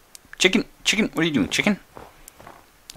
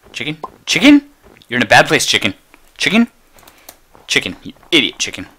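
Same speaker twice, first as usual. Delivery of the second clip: calling out; 5 semitones higher, 12 dB louder than usual. Real, not acted. Yelling at a chicken.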